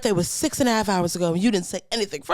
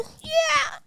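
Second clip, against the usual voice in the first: put-on high swooning old lady. Old women enjoying stripper.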